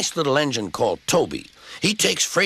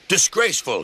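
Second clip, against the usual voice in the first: spluttering; 8 semitones higher than usual. A narrator's reading. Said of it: "he spluttered".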